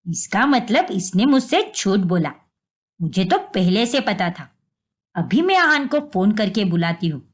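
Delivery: stern mother-in-law. Acted. Mother-in-law discovers lie.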